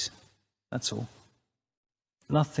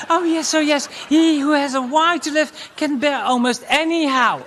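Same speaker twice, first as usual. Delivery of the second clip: higher, louder hammed-up ecstasy; mock high woman; 10 semitones higher, 4 dB louder than usual.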